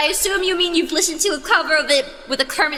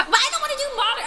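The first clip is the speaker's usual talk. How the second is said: laughing; 9 semitones higher, faster than usual. Real, not acted. Laughing protest.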